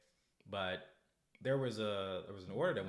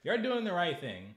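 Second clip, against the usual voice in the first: loud, higher fervent support; higher, louder fervent praise; passionate praise; 6 semitones higher, 6 dB louder than usual. Real, not acted. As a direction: fervently back the protesters.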